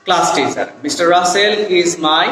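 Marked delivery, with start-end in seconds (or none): none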